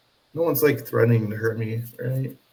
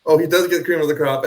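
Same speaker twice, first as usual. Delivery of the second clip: ordinary talk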